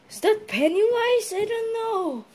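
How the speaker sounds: very high, stretched-out wail; frightened wail. Acted. Spotting a scary clown, frightened wail. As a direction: wail it high, like a scared child.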